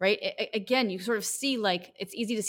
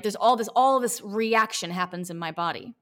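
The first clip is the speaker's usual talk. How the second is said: usual speaking voice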